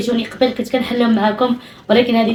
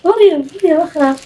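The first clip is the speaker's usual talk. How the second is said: excited mother; 7 semitones higher, 3 dB louder than usual. Real, not acted. Mother showing daughter another doll, high and delighted.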